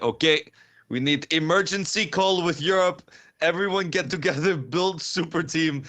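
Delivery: high, heavy put-on Russian accent, slowing on the last words; Cage in a bad Russian accent. Podcaster quoting a streamer's super-team rallying call, in a bad Russian accent. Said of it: "sorry about the accent, it was terrible".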